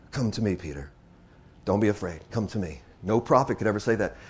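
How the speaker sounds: low, hushed words of reassurance; tender preaching. Acted. Jesus calling a fearful Peter to come to him, tender preaching. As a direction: drop down low and invite them gently.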